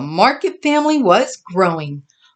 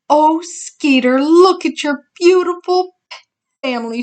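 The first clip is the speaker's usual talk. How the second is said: admiring; 8 semitones higher than usual. A narrator's reading. Mama admiring babies.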